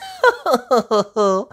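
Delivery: a light, high, airy chuckle; high fluttery laugh. Acted. Teacher laughing fondly at impatient Peppa, high. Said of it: "Madame Gazelle laughs".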